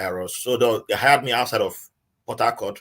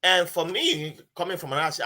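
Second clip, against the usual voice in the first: ordinary delivery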